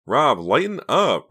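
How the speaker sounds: slow, loud mocking tease; teasing. A narrator's reading. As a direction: drag it out, mocking him. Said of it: "enjoying his distress immensely".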